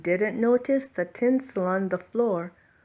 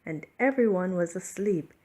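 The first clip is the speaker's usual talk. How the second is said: ordinary delivery, nothing marked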